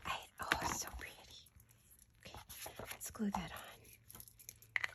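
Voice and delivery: hushed whisper to herself. Real, not acted. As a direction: breathe the words almost silently. Crafting, talking to herself softly.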